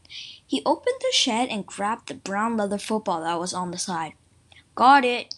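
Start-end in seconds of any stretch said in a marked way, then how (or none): 4.8-5.4 loud storybook shout; acted scream. A narrator's reading. Joe to his brother with the football, acted scream. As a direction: call it out much louder. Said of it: "Joe screamed to me".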